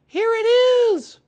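put-on high, loud, drawn-out boyish shout; excited cry. A narrator's reading. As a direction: cry out the find in triumph. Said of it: "cried Christopher Robin excitedly".

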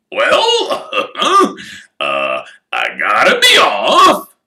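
loud gruff chuckle then slow farewell; embarrassed giant taking leave; Hagrid laughing off. Acted. Hagrid leaving as his hand is wrung, loud and laughing. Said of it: "said Hagrid".

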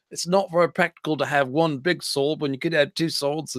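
botched mimicry of a co-host's accent, sounding vaguely Australian; terrible Robert impression. Teasing co-host about practical approach, badly imitated accent. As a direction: do a bad, off-the-mark accent of your colleague. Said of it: "it's a terrible Robert, uh, impression".

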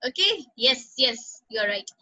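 normal talking voice

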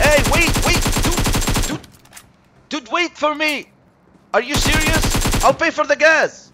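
high, loud plea to stop; frantic, indignant calling out; desperate shouting. Pretend. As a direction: yell after a car leaving you behind. Car drives off without them.

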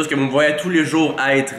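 usual speaking voice

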